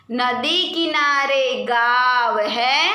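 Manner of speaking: slow sing-song poem reading; children's poem recital. Acted. Teacher reading a poem to pupils, slow sing-song. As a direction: recite it slowly like a nursery rhyme.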